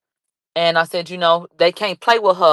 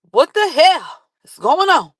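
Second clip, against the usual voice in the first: excited disbelief; 8 semitones higher than usual. Real, not acted.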